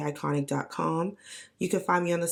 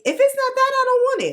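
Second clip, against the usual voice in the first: much higher, loud dramatic cry; mock diva shout; 7 dB louder, faster than usual. Fan claiming her crush, shrieking high and loud. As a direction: wail it like a spoiled diva. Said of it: "I was like".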